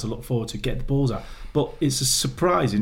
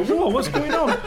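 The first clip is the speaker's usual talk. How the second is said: laughing mock bafflement. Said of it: "you go".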